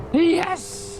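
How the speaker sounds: sudden high yelp of yes; excited shout. Real, not acted. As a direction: burst out with a triumphant yes. Player cheers the answer.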